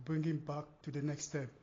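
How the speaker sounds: usual speaking voice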